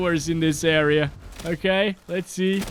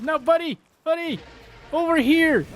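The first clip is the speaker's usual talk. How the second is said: calling out; 11 semitones higher than usual. Real, not acted. Calling pal back in game.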